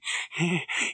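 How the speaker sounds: high, creepy henchman's chuckle; reminiscent chuckle. A narrator's reading. Krebs recalling the tortured spy with a chuckle. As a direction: chuckle fondly at the memory. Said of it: "Krebs chuckled reminiscently".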